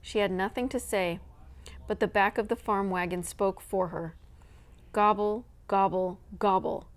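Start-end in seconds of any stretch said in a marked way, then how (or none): 4.9-6.9 loud gobbling turkey calls; turkey gobbles. Acted. Turkeys calling from the wagon, loud gobbling. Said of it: "cried the wagon load of tired and hungry turkeys".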